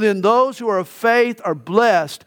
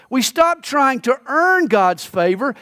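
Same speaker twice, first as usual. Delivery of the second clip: usual speaking voice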